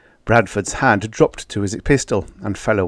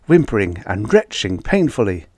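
ordinary delivery, nothing marked

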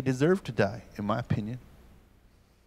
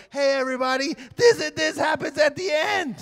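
high shouted announcement to the whole theater; spoiler shout; 11 semitones higher than usual. Retold or imitated. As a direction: yell the ending out to everyone. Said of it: "he goes".

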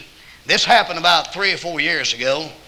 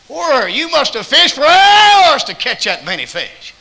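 high, loud, slow wonder; amazed stranger; 9 semitones higher, 8 dB louder, slower than usual. Retold or imitated. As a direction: marvel at the huge catch.

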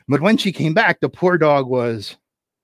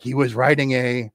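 ordinary delivery, nothing marked

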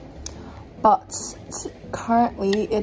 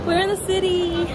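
excited gasp; 9 semitones higher than usual. Real, not acted. Arriving in the city.

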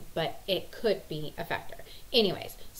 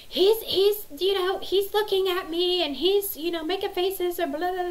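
put-on much higher, louder tattling kid voice; imitating whiny daughter; 12 semitones higher, 6 dB louder than usual. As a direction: mimic a complaining daughter, pitched way up. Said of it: "Haley says".

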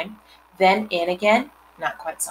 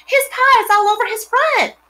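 put-on high, loud little girl's shout; girl shouts at her brother, high and loud; 6 dB louder than usual. A narrator's reading. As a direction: yell it out as the excited girl. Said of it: "shouted Fern".

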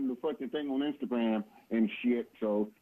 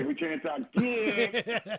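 laughing; 11 semitones higher, much slower than usual. Real, not acted. Playful reply, high slow laugh.

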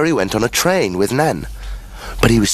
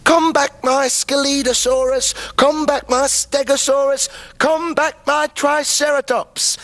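much higher, ringing call for each dinosaur. A narrator's reading. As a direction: call them back across the room, eyes shut. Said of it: "He called".

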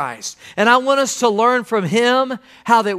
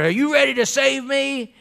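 higher-pitched, lightly acted demand; imitated rough guy; 4 semitones higher than usual. Rough guy plopped down before the pastor, mock demand. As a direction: put it as a blunt, mock demand. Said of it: "He just plopped down".

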